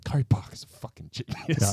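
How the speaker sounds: hushed, awed whisper; whispered praise. Real, not acted. Fan praising the director, in an awed whisper. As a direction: breathe it out like a secret.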